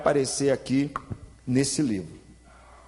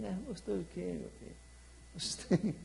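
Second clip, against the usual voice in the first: softer, mumbled husband's answer; hushed clueless reply; 14 dB quieter than usual. Retold or imitated. Husband mumbling a reply to his wife, hushed and clueless.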